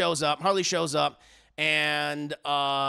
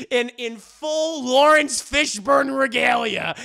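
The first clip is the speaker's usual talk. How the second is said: ordinary talk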